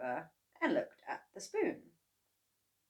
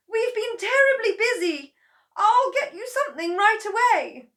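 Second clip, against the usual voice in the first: very high, loud, near-tearful plea; apologetic daughter; 12 dB louder than usual. A narrator's reading. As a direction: beg her mother's pardon. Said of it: "Missus Cranky said".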